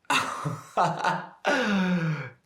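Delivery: high, loud laugh; big teasing cackle of a laugh. Acted. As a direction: burst out laughing at the joke. Laughs at own joke about mum.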